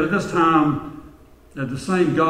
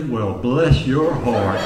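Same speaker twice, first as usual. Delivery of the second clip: mild Southern lady, sweetly slow; Southern drawl; 2 semitones lower, slower than usual. Acted. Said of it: "soft-spoken woman from the South".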